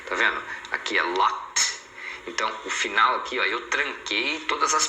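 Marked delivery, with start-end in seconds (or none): 1.0-1.8 LOCKED ending in a crisp T, "lockt"; slow "locked" with the ED said as T. Shown as a demonstration. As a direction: finish "locked" on a T, no extra syllable. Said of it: "Esse E D tem o som de T".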